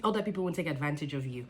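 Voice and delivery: ordinary delivery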